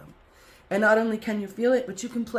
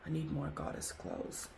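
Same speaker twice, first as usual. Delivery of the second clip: whispered aside; 13 dB quieter than usual. Real, not acted. Teary-eyed aside about attire.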